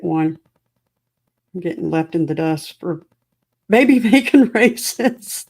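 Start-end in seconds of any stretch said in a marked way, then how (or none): 3.7-5.5 loud, slow laughter through words; laughing. Real, not acted. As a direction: crack up mid-sentence. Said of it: "Thanks for making me laugh".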